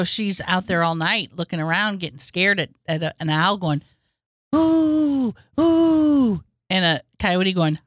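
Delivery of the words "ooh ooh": high imitated owl hoots. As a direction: hoot like an owl.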